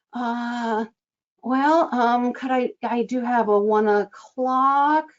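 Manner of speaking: slow, hesitant "could I, I do have a one o'clock" left unfinished; hanging inflection. Shown as a demonstration. Coach imitating a scheduler searching the grid, hesitant and hanging. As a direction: trail off, leave it hanging. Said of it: "you kinda get hanging".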